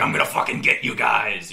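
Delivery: loud, gruff Batman growl; Batman style voice. Retold or imitated. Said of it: "going almost Batman style".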